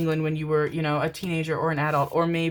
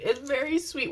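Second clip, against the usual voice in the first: much higher repeat; high-pitched gushing; 9 semitones higher than usual. Real, not acted. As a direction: say it sweetly, way up high.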